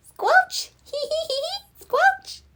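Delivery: very high squelch and giggle; high-pitched puddle-jumping glee; gleeful giggle. A narrator's reading. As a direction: squeal the splashes with a tittering hee-hee.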